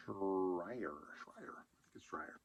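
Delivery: whispering the name to himself; whispered name. Real, not acted. Working out a friend's surname, whispering. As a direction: test the surname under the breath. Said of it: "I think his last name's pronounced Schreier".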